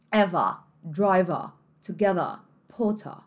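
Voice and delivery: British endings, each word closing on ah. Shown as a demonstration. Teacher listing examples, British accent. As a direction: drop the R British-style in driver, together, Potter. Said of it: "vai ter um som de A".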